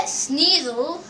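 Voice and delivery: ordinary delivery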